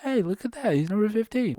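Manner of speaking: squeaky high-pitched excitement; high excited voice. Pretend.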